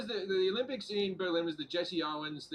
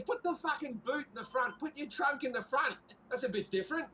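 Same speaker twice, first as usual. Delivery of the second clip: Hitler's voice done higher, not really menacing; 6 semitones higher than usual. Retold or imitated. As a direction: play Hitler higher, keep the mock lightweight. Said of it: "he goes".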